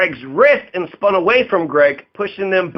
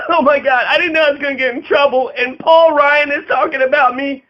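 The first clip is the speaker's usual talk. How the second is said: high, fast, whimpering mockery; mock whiny panic; 7 semitones higher than usual. Host mocks Gianforte panicking about trouble, whiny and fast. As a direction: play a scared crybaby caught out.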